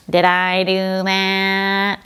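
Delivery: very nasal, slow, drawn-out catchphrase; caricatured nasal whine, stretched out; Steve Urkel. Acted. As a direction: imitate a nasal sitcom nerd. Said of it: "you might remember Steve Urkel from Family Matters".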